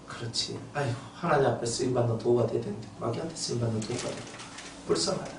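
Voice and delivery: hushed compassionate prayer; soft prayer. Retold or imitated. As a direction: pray quietly with pity. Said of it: "저는 그렇게 기도했거든요".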